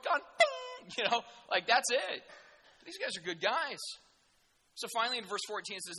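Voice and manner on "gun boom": high-pitched sound effect, boom; imitated boom. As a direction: mimic the boom in a leap of pitch. Mimics a sailor thrown overboard.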